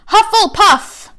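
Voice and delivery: loud, high, drawn-out call of the house; booming Sorting Hat proclamation; hat shouting. A narrator's reading. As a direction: announce it to the whole hall.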